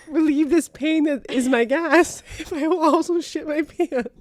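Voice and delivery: high, strained voice, still laughing through the words; fighting back tears of laughter; laugh-crying. Real, not acted. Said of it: "I'm sorry, I'm crying".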